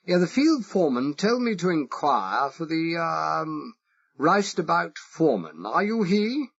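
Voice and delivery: exaggerated posh English accent. Spy asking the Irish foreman, exaggerated posh English accent. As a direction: play the harmless toff with a marked English accent. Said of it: "I exaggerated it".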